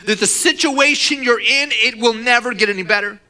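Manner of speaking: loud, high preaching surge; emphatic preaching. Real, not acted. Preacher warns church of the enemy's lie, emphatic. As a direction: raise pitch and volume for emphasis.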